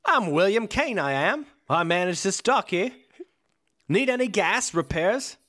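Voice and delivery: lightly done British dock manager; a hint of a British accent, friendly pitch. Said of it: "No, it's British".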